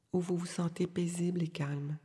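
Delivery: ordinary delivery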